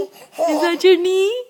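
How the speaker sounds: loud, high, slow delighted question. Real, not acted. Same question, rising excitement.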